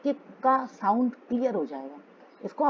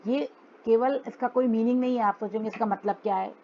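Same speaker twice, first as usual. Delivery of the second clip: ordinary talk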